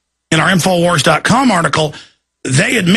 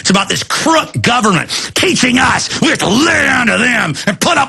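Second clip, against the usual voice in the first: angry rant; 3 semitones higher than usual. Real, not acted. Ranting at the TSA agents, climbing to a furious shout.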